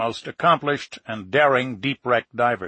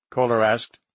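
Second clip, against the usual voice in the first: plain reading voice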